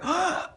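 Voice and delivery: quick high gasp for air; imitated gasp. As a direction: suck in air like a choking baby. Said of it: "he lets a gasp of air".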